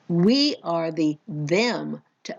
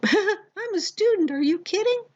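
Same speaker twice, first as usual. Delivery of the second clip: incredulous student; 12 semitones higher than usual. Retold or imitated. Voicing the student's reply.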